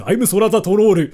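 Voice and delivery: heavy put-on Japanese-accented English; exaggerated Japanese accent. Shown as a demonstration. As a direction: lay the Japanese accent on thick. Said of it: "I intentionally spoke English like this".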